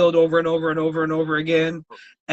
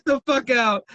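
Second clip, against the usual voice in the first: normal talking voice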